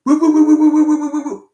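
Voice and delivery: high, loud woo-woo yapping like a neighbour's dog; imitated dog barking. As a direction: bark it out like a yappy little dog. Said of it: "it barks at you".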